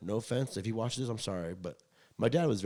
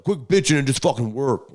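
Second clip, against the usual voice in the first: louder scolding dad; angry dad; 5 semitones higher, 13 dB louder than usual. Retold or imitated. Imitating his dad scolding him at the horse, mock angry. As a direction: snap at him like a fed-up father. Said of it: "he's like".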